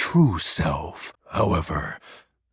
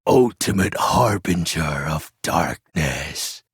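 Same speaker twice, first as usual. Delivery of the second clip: ordinary talk, nothing marked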